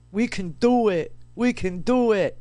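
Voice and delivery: lightly voiced tough gal; a hint of a tough broad, each line falling; imagined Rosie the Riveter. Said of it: "Isn't how I imagine her to s-, to sound".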